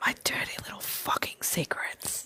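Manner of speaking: giggly hushed whisper; whispering on the phone. Real, not acted. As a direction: whisper it playfully. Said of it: "I have to whisper".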